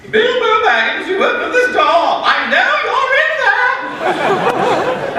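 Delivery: a nosy relative calling through the door; shrill lady caller. Acted. Neighbour lady shouting at Bilbo through the door, high put-on voice. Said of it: "It's the lady".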